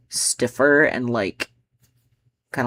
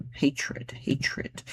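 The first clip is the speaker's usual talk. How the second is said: whispered; 7 dB quieter than usual. Shown as a demonstration. Poet trying the line hatred in a whisper. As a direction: whisper it twice, no voice.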